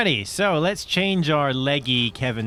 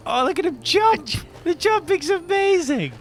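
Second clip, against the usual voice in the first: delighted. Real, not acted. Delighted at game character.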